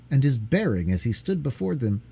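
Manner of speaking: usual speaking voice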